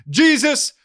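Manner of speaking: mock preacher testimony. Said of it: "someone speaks and they're like".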